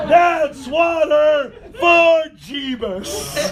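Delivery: slow, drawn-out imitated toast; drunken triumphant bellow. Storyteller as drunk buddy grabbing the vodka, triumphant bellow. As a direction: bellow it like a drunk raising the bottle.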